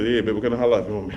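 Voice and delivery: low, smooth come-on voice; a hint of a smooth player; smooth pickup line. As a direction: croon it to the lady like a player.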